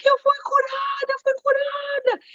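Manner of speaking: high, far-carrying retold cry of joy; healed woman shouting to the whole church, high and loud. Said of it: "ela chorava muito alto".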